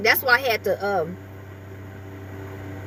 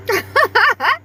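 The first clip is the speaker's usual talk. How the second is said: high, teary burst of laughter; crying laugh; 10 semitones higher, 3 dB louder than usual. Real, not acted. Owner crying with laughter at her talking poodle. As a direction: laugh till it hurts. Dog tries to talk.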